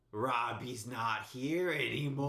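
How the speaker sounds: slow, eerie mock-psychopath voice; possessed alter ego. Storyteller imitating drunk friend's sudden switch, slow eerie alter ego. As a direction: imitate a drunk friend's sinister other self, very slowly. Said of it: "And he literally just went".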